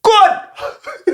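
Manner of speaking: very high, very slow drawn-out craving; longing for pork. Retold or imitated. Staring at his wife's pork, very high and drawn out. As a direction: stretch it out, high and hungry. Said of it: "and me I say".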